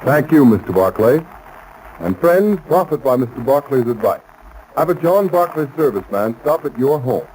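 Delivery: ordinary delivery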